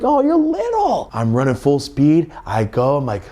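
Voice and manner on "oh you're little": put-on much higher, slow baby-talk coo; mock cooing. [name] cooing at the tiny kicker in mock sympathy, high and slow. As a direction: coo at him like a toddler.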